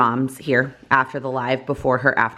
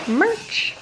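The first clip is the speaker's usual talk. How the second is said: excited squeal. Real, not acted. Announcing the vlog's focus.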